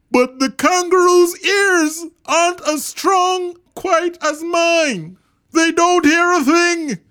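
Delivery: big high character voice, calling loudly; rally them with a loud, high earnest call; Horton calling out. A narrator's reading. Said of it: "And Horton called back".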